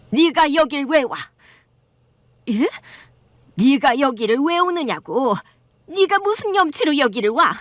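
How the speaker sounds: contemptuous mother-in-law snapping at her son's wife; hostile mother-in-law. Acted. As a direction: sneer at the daughter-in-law. Mother-in-law voiced in story.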